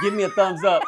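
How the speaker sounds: loud, high mock order; retold demand. Driver taunting the man who backed down, retold demand. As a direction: order it loudly through laughter.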